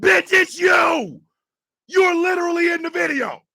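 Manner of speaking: high, loud disbelieving shout; outraged shout at the guy in the video; incredulous yell. Real, not acted. Ranting at the apologizer.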